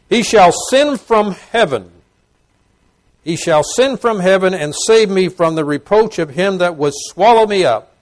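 high, loud verse reading; ringing pulpit declamation; forceful scripture proclamation. Real, not acted.

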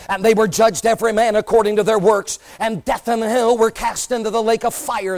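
loud, rising scripture reading; preaching at full force. Acted. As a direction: thunder the verses out to the congregation.

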